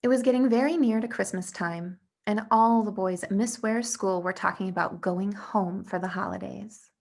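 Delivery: plain reading voice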